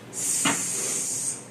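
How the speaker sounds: a long voiceless sss, no vowel; just hiss the S of sabão; sustained sss like a snake; hissed S sound. Shown as a demonstration. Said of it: "O sin tem som de S em sabão".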